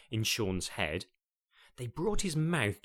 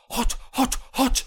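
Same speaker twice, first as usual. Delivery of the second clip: much higher, louder alarmed yelps; yelping in pain; 13 semitones higher, 9 dB louder than usual. Acted. Sean catching the hot duck, yelping in alarm. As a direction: yelp as if burnt fingers.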